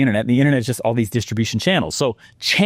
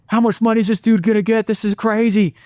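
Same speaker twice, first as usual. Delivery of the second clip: eager excitement; 8 semitones higher than usual. Retold or imitated. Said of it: "and I'm like".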